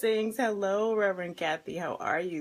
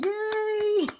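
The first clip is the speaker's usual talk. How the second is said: excited cheer; 13 semitones higher, 6 dB louder than usual. Real, not acted. Host cheers joining viewers.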